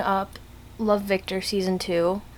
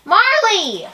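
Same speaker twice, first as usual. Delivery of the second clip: loud high call to the dog; 12 semitones higher, 7 dB louder than usual. Real, not acted. Calling out to the dog Marley, loud and high. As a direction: shout the dog's name across the room.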